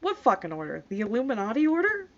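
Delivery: usual speaking voice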